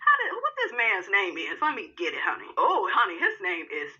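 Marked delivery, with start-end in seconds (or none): none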